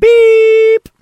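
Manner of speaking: loud, high-pitched beep sound. Pretend. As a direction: squeal out a beep like a machine. Imitating voicemail beep.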